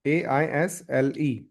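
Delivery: letters spelled one by one, slowly; teacher spells the word letter by letter for the class. Shown as a demonstration. Slow spelling for students. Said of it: "How will you read this?".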